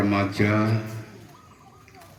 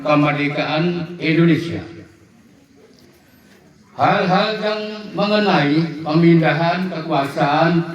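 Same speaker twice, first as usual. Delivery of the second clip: formal proclamation reading; 6 semitones higher, 9 dB louder than usual. Acted. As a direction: proclaim each phrase with ceremony. Reads the proclamation text.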